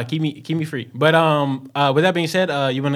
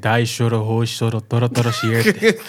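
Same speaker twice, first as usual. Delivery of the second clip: laughing gibberish Japanese; 6 semitones lower than usual. Pretend. Made-up Japanese name, laughing.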